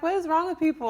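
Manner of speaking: high, loud exasperated retort; mock outrage. Friend retells her reaction to a rude message, indignant. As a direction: exclaim it in comic disbelief.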